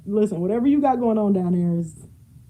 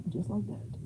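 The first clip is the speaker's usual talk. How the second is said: sighed whisper; 13 dB quieter than usual. Real, not acted. Sigh then whisper to herself.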